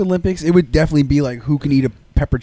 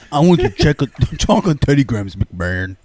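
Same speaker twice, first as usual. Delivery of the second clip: wrestler impression voice; 2 semitones lower, 3 dB louder than usual.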